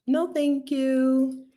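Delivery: high, slow, drawn-out no thank you; emphatic polite refusal. Real, not acted. Speaker turning down the country, high drawn-out refusal. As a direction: stretch it out high.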